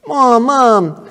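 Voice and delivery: mock-whine like a kid interrupted at play; whining kid. Kid protesting mom's command.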